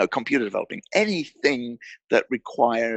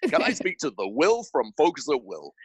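a light, slightly higher imitation of a polite doctor on the phone; imitating Ned Hallowell; 5 semitones higher than usual. Psychiatrist calling the startup, lightly imitated and polite. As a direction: mildly voice the famous caller introducing himself. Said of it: "You know Ned's voice. He goes".

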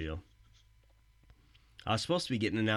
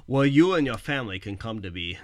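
ordinary delivery

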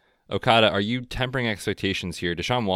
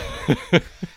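high breathy laugh; burst of laughter; 9 semitones higher, 5 dB louder than usual. Real, not acted. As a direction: laugh out loud at the accent. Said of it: "Oh my gosh".

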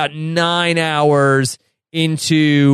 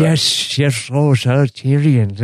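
spit-filled fawning courtier voice; a wet, spitty imitation of Varys; 2 semitones lower, slower than usual. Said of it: "sounds like his mouth is just filled with spit".

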